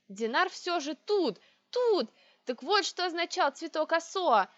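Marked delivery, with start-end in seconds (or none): none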